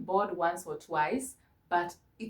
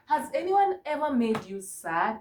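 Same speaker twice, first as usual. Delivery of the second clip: ordinary talk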